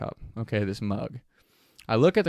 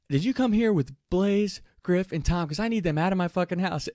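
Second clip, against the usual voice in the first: angry demand; 6 semitones higher than usual. Retold or imitated. Angry host demands they leave.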